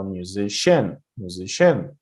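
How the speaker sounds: musiCIAN, last syllable stressed as "SHIN"; musician mis-stressed on its final syllable; wrong stress. Shown as a demonstration. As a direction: hit the end of musician. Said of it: "it sounds weird".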